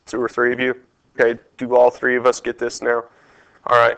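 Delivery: ordinary delivery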